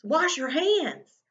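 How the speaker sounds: emphatic and excited; excited emphasis. Shown as a demonstration. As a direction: say it with the exclamation point. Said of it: "This is important".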